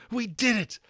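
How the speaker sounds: high, loud jubilant cry; triumphant cheer. A narrator's reading. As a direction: cheer the win. Boys hugging after victory.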